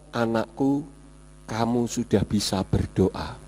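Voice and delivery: suara Tuhan, jauh lebih rendah dan lembut; bisikan mantap. Retold or imitated. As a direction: berbisik tenang tapi mantap. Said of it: "Tuhan berbicara seperti orang berbisik dengan suara yang mantap". (suara Tuhan berbicara kepada saya, berbisik mantap)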